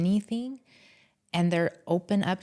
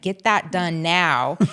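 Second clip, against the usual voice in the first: slow, louder mock order, each word landed; bossy demand; 6 dB louder, slower than usual. Imagining what she'd tell her husband about the shoes, slow mock order. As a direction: play the impatient wife barking a command. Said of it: "I would have been like".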